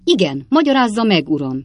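angry shout. A narrator's reading. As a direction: snap it out angrily. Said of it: "kiáltott fel dühösen". (dühösen felkiáltva)

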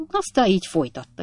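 ordinary delivery, nothing marked

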